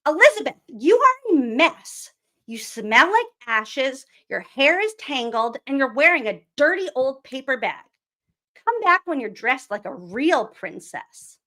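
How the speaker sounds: high, loud, snooty prince scolding her; haughty prince. Acted. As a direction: look down your nose at her as the prince. Prince Ronald scolds Elizabeth in story.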